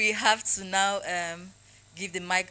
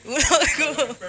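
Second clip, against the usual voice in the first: playful loud; 8 semitones higher, 11 dB louder, slower than usual. Pretend. Joking reply after laugh.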